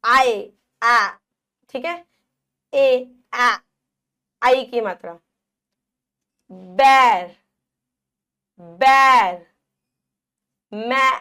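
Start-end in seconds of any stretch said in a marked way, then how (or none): 0.0-1.1 आए said slow, loud, mouth wide; wide open ai. Shown as a demonstration. Teacher showing the wide-mouth ai sound. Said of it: "एक तो बड़ा मुंह खुल जाएगा". 6.5-9.5 बैर loud with mouth opened wide. Shown as a demonstration. Teacher showing children the ऐ in बैर. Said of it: "बड़ा मुंह खुल रहा है".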